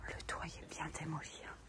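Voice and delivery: hushed whisper, barely voiced; library whisper. Real, not acted. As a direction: whisper so nobody hears you. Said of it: "en discrétion absolue". (chuchotement discret)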